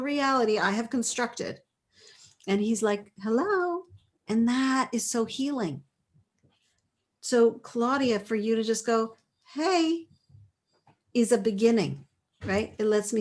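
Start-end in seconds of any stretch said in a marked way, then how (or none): 3.2-3.8 high, lilting hello; imitated husband calling. Wife imitates husband reaching out to her, lilting call. 9.5-10.1 high-pitched, lilting hey; a friendly little call for contact; calling hello. Acted. Coach voices client just saying hi, high lilting call.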